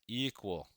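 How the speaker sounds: "equal" lifted and set apart; stress on equal. Shown as a demonstration. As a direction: lean on "equal" as the key word. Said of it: "underline that word".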